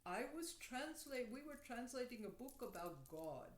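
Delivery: imitated Indian accent with a swooping lilt. Little man tells stranger about his translation, Indian accent. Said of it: "he speaks with an accent".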